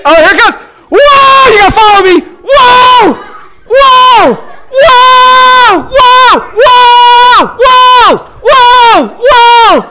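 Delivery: high shouted whoas of a coaster rider; pretend roller coaster. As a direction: scream along like on a ride. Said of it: "I want everyone to participate".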